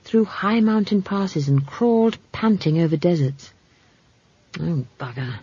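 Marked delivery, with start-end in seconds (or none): 4.6-5.4 low, soft muttered curse; under his breath. A narrator's reading. Man watching the girl walk off, muttering. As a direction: mutter it quietly to yourself. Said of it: "he said under his breath".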